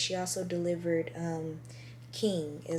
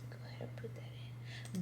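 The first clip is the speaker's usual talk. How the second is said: whispered aside; 11 semitones lower, 11 dB quieter than usual. Real, not acted. Aside to the editor.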